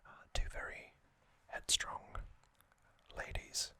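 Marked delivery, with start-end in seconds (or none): none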